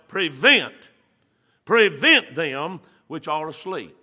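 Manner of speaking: prevent said loud and slow, drawn out; preacher pounding the word prevent; stress on prevent. Shown as a demonstration. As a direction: lean hard on prevent. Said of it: "listen to this word now".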